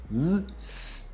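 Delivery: voiced z buzz then voiceless s hiss. Shown as a demonstration. Teacher contrasting z and s sounds. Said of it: "Um é tipo z, o outro é s".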